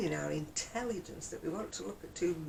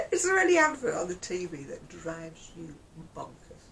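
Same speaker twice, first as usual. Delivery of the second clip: ordinary talk, nothing marked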